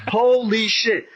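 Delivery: high, punched-out disbelief; retold shock. Telling friends how his hardest kick did nothing, retold shock.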